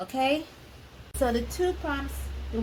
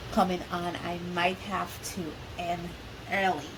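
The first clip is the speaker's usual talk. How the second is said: ordinary talk, nothing marked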